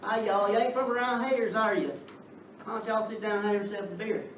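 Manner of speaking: put-on welcoming a stranger in a Southern accent. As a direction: play a friendly Southern host in a Southern accent. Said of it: "people talking like this".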